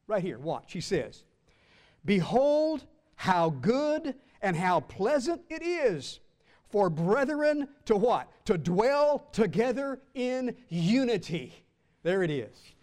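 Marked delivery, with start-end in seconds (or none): 2.0-11.7 slow solemn scripture reading. Shown as a demonstration. Quoting the Psalm with weight.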